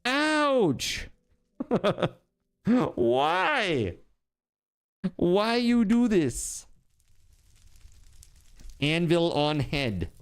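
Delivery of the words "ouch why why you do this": whiny high voice. Pretend. Whining after anvil hit.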